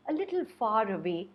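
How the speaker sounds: usual speaking voice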